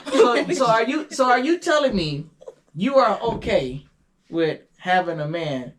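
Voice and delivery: ordinary talk, nothing marked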